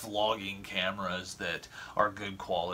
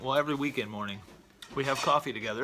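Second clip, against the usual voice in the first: ordinary delivery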